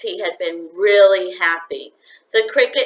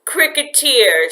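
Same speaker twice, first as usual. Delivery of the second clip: louder, a sneer on the word; scornful; 6 semitones higher, 6 dB louder than usual. A narrator's reading. Mother mocking her son's pet, scornful. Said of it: "she said scornfully".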